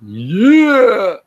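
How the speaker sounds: high, loud mock roar of Yeah. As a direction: bellow Yeah up high, hammed-up. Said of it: "I roared".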